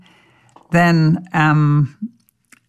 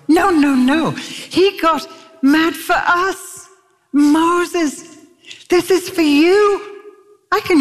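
much higher, slow, fervent; fervent preaching; 12 semitones higher, slower than usual. Acted. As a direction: preach it with rising passion. Passionate preaching climax.

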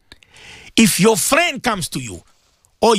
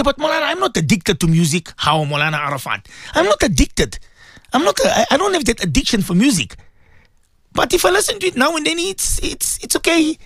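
a light higher whining listener voice; excuse-making listener; 8 semitones higher than usual. Retold or imitated. Listener excusing music to the Maulana, lightly mocked whiny voice. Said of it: "They say".

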